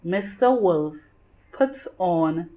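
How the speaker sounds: usual speaking voice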